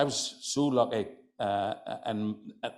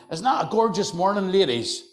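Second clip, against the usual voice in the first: bright, loud, higher cheerful hello; cheery greeting; 9 semitones higher, 8 dB louder than usual. Retold or imitated. A man teasing dour shop women, loud and cheery.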